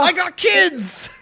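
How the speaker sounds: high, drawn-out mock plea; hammed-up begging; desperate pleading. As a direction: wail it like a desperate debtor in a skit. Pleading as duelist in skit.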